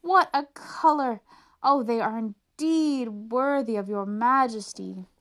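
overdone fawning praise; nobles crying out. Acted. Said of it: "cried all the nobles".

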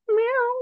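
very high squeaky meow; cat meow. Acted. The cat answering its owner with a very high meow.

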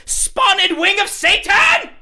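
high drawn-out Ace Ventura yell; Ace Ventura shout. Retold or imitated. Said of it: "He's like".

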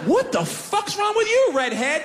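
confused angry stranger; incredulous driver. Retold or imitated. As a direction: spit it out in disbelief. Driver insulting.

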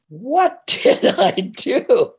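high, slow dismayed cry; panicked. Retold or imitated. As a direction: realise the mess with laughing dread. Panicked realisation after job offer.

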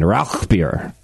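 Rauchbier with guttural ch; throaty German ch in Rauchbier; German pronunciation. Shown as a demonstration. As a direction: say Rauchbier as "rowkh-beer". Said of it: "if you did it in German".

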